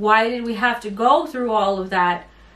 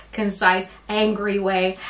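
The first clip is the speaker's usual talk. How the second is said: usual speaking voice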